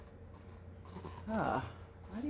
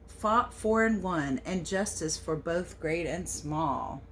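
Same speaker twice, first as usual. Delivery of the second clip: storybook read-aloud; 4 dB louder than usual. A narrator's reading. Reading the American Pie book aloud to children, storybook lilt. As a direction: read the verse a little louder, with a gentle lilt. Reads the picture book text.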